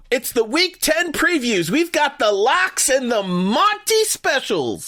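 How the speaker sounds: high, punchy promo read; hype announcer. Acted. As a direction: sell it like a radio promo. Hyped preview of show segments.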